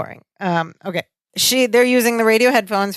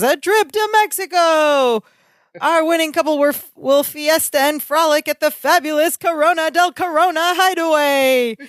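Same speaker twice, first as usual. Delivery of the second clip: announcer voice; 6 semitones higher than usual. Retold or imitated. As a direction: boom it out like a prize announcer. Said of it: "he turns into Johnny, the voice announcer".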